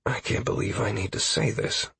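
weary sigh. A narrator's reading. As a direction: sigh the words out softly. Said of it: "I sighed".

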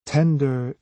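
usual speaking voice

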